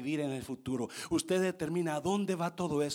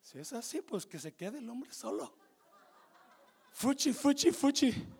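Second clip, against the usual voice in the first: whispered, then a higher, mocking "fuchi, fuchi"; mock wife's objection; 10 semitones higher than usual. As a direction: play the scornful wife lightly. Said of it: "Quizás me dirá, mujer".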